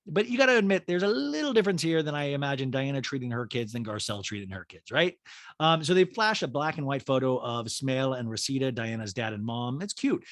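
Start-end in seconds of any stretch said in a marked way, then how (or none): none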